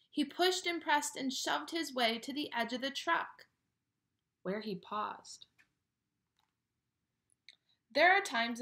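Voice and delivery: put-on lively read-aloud to children; animated picture-book narration; storybook reading. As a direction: read it like bedtime story time.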